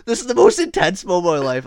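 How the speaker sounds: loud, high retold panic; freaking out. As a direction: imitate a friend losing his cool. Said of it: "Devin's freaking out".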